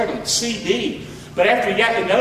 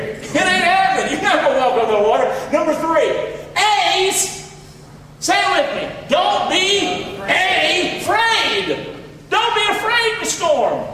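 full-force preaching shout; 9 semitones higher than usual. Acted. Preacher telling the church not to fear, shouting.

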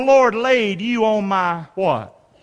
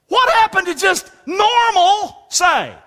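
exasperated shout; 9 semitones higher, 3 dB louder, slower than usual. Real, not acted. Preacher exasperated at TV.

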